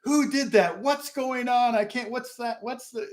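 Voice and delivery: high, startled questions tumbling out; a touch of panicked wedding guests; confused panic. Retold or imitated. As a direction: sound like you just swam from a burning boat. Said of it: "and they're like".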